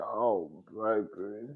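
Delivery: slow, soft half-sob half-laugh; laughing lament. Retold or imitated. Quoting herself over Kenya, a laughing mock sob. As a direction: mourn her while laughing. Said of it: "I said".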